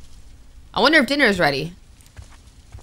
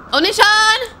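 calling out; 11 semitones higher than usual. Real, not acted. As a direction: call out for her, searching. Calling out for missing sister.

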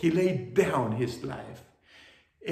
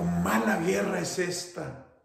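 high, loud grieving lament; grieving mother; 8 semitones higher, 8 dB louder than usual. Acted. Mother laments what war did to her son, loud and grieving. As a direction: cry out against the war. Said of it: "la madre sentida llorando".

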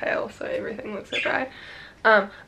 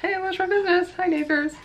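much higher, chirpy mock hello; mock neighbor greeting; 9 semitones higher than usual. Joking about the old-school neighborhood party, mock cheerful greeting.

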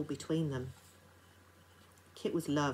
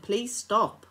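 louder, desperate plea; pleading; 5 semitones higher, 9 dB louder than usual. Acted. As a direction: beg her to stop. Housekeeper begging her to stop.

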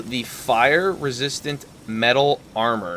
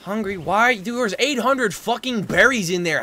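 high-pitched, incredulous scolding; exasperated, voice pitched right up; exasperated complaint; 9 semitones higher than usual. Real, not acted. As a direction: demand an explanation from useless helpers. Scolding game creatures stuck hungry.

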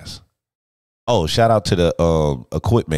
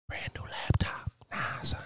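whispered dismay; 13 dB quieter than usual. Real, not acted. Muttering after spilling coffee.

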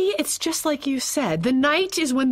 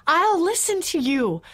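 higher, urgent begging; earnest pleading; 6 semitones higher than usual. Acted. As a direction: beg him to be the sensible one. Wife begging husband to talk sense.

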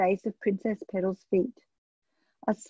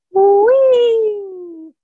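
much higher, loud, long gleeful whee; joyful shout; 11 semitones higher, 9 dB louder than usual. A narrator's reading. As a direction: shout for joy while flying. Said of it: "shouted Princess Petal, louder than she had ever shouted".

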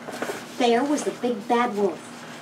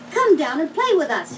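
calling out; 6 semitones higher than usual. A narrator's reading. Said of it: "he called".

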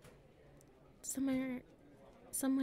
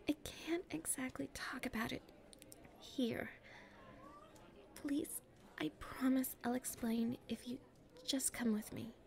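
hushed and secretive, keeping it private; nervous confiding whisper; shy whisper; 4 dB quieter than usual. Acted. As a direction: whisper so nobody around can hear.

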